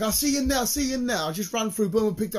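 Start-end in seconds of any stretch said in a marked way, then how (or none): 0.0-1.2 much higher, eager rush of words; bolting to the shop door, full of glee; giddy excited call. Retold or imitated. Reliving the dash to the door, high and giddy. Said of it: "It's like".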